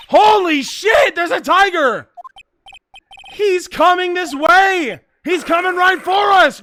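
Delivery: loud, high cry of alarm; panicked shout. Acted. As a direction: shout a frantic warning. Acts out alarm at charging tiger.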